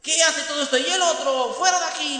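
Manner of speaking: high, loud barked yells; dictator's shout. Acted. Hitler-like singer shouting at the crowd. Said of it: "les decía".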